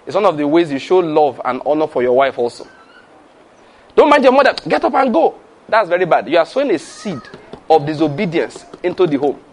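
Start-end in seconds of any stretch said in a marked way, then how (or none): 3.9-5.3 dismissive father. Acted. Father tells child to ignore mother, high and dismissive. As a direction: play a father waving the objection away.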